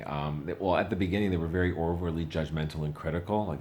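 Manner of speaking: usual speaking voice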